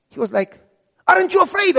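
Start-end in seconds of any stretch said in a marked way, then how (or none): 1.1-1.7 high, loud screamed question; screaming official. Retold or imitated. Church official screaming at young pastor. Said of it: "he started to scream".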